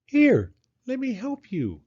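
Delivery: high, slow, kind little hedgehog; offering help. Acted. Hedgehog offering to help Mole tidy, high and slow. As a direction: gently offer a hand. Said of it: "offered Hedgehog".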